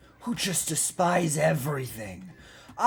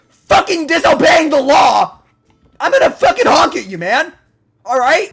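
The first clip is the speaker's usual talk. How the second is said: high, loud outburst; angry yelling; 8 semitones higher, 12 dB louder than usual. Real, not acted. Ranting at phone users who disobey the law, angry yelling. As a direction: build into a furious yell. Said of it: "I wasn't yelling so much".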